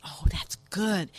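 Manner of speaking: slow hushed whisper back. Acted.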